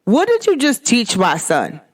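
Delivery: ordinary talk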